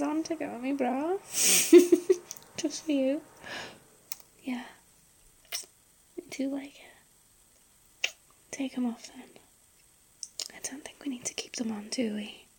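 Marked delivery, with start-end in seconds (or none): 1.2-2.3 laugh. Real, not acted. Laughs after teasing line. 6.2-11.9 soft intimate whisper; breathy close-mic whisper. Acted. Whispered intimate roleplay throughout.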